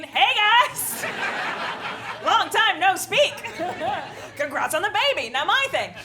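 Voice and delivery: high, loud, overly chirpy greeting; bubbly fake group chat. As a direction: chirp it like a gushing old friend. Mock group chat message.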